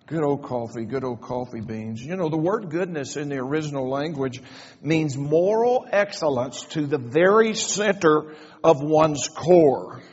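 ordinary talk

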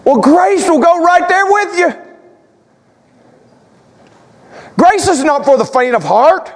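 high, projected preacher's proclamation; full-force preaching. Real, not acted. Pastor proclaims grace to congregation, full-force preaching.

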